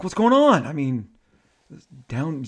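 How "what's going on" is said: high, loud mock-indignant question; exasperated. Shatner complains about the hotel, exasperated. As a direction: imitate someone fed up, voice raised. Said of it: "he was exasperated".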